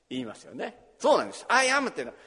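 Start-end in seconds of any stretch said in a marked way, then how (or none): 1.5-1.9 "I am" said much higher and louder, both words hit; "I am" ringing out over the congregation; emphatic I am. Acted. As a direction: punch out "I am" as the key phrase. Preacher stresses the key words.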